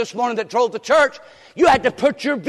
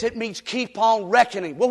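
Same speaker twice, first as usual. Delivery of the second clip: normal talking voice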